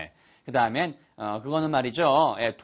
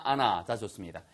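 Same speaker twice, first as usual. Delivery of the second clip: usual speaking voice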